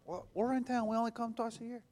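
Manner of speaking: mildly mocking a grumbling relative; whiny relative. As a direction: whine like put-out family in town. Family complaining about church visit.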